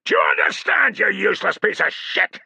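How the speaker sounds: loud, high furious roar; shouting fury; thundered. A narrator's reading. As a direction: thunder at him. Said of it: "thundered Tullus".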